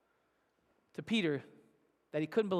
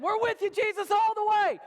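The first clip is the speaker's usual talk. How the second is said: high shouted pledge, building louder; acted cry of loyalty, pitched way up; mock rallying shout; 10 semitones higher, 5 dB louder than usual. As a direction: shout it like an eager follower. Preacher voices followers' bold pledge.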